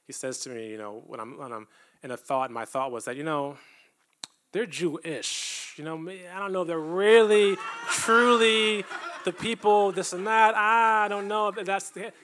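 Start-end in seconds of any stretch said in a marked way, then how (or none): 4.5-12.1 mocking his own doubt. Voicing his own doubting thought.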